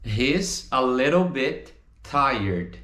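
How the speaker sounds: "He's, a little bit, tired" in slow pieces; slow, separated model. Shown as a demonstration. Teacher models the answer for students, slow and separated.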